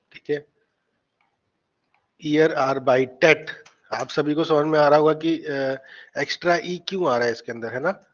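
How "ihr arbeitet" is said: Ihr arbeitet drawn out, crisp final t; slow model pronunciation. Shown as a demonstration. Teacher models ihr arbeitet for the class, slowly. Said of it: "इसको ऐसे ही बोलना है".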